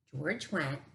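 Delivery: ordinary delivery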